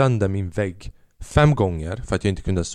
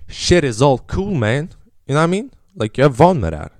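acting cool; 6 semitones higher, 4 dB louder than usual. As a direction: play it like you've done this a hundred times. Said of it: "Jag ska lika som att".

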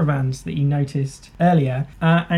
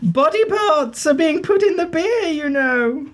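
put-on high, conspiratorial old lady voice; a prim busybody spreading dark rumours; gossiping old lady; 10 semitones higher than usual.

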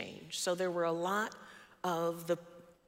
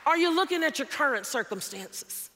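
loud, rising sermon cadence; preaching at full force; 4 semitones higher, 4 dB louder than usual. Acted. Pastor exhorting the congregation, loud and rising. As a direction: build it like a pulpit appeal.

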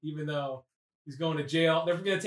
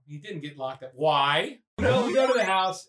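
ordinary talk, nothing marked

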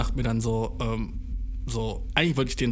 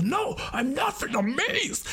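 much higher, slow, breathy protest; guy denies looking Vietnamese in a high flustered voice; imitating the Chinese guy; 13 semitones higher, slower than usual. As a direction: squeak out a flustered denial. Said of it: "Und er war so".

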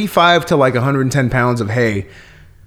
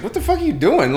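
a startled what-the-hell outburst, higher; 10 semitones higher, 4 dB quieter than usual. Retold or imitated. Dad grabbed in the dark by a cop, startled outburst. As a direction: jump at it like you got grabbed in the dark. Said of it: "he just instinctively is like".

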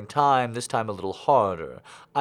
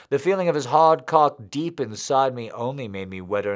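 ordinary delivery

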